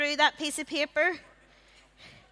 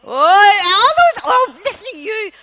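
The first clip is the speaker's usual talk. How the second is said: excited exclaim; 6 semitones higher, 11 dB louder than usual. Real, not acted. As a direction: cry out in delight. Host reacting to the crowd.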